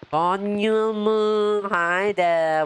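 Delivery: high, slow sing-song hello; drawn-out greeting. Real, not acted. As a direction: stretch the name out to welcome a viewer. Greeting a stream viewer.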